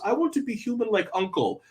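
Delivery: put-on high, soft little-kid voice; small, high-pitched longing; wistful child. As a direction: plead softly, like a small child.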